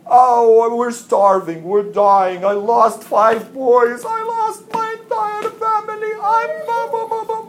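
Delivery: high, loud hammed-up wailing; mock wailing. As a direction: beg tearfully, over the top. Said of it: "people are really crying".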